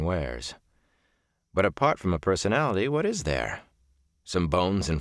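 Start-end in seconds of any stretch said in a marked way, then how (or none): none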